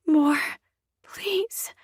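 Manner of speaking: rising, breathy plea; breathy whisper. A narrator's reading. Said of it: "as I whisper".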